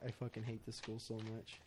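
low, very quiet, defeated; super depressed. Retold or imitated. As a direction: say it under your breath, utterly down. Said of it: "just super depressed".

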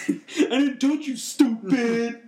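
high, slow, laughing whiny protest; imitating Ryback. Co-host imitates Ryback's line, laughing: high slow whine. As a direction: whine it, giggling through. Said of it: "Literally what he said, and how he said it".

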